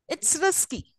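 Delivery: "it's risky" pitched high, then falling. Shown as a demonstration. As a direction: start "risky" high and let it drop. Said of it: "High fall".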